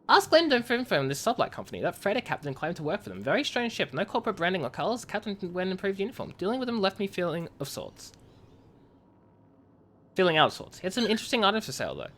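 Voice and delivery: lightly voiced in-character message read; reading game messages. Streamer reads a miner's report to chat, lightly acted. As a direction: read the colony log like a letter home.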